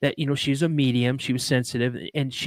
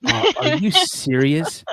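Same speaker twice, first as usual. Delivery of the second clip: high, stunned shut up, slowing right down on are you serious; like someone who can't believe what a stranger just told him; stunned disbelief; 6 semitones higher, 3 dB louder, much slower than usual. Retold or imitated. Retelling how he answered the medium who named his late grandmother, with stunned disbelief. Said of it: "And I go".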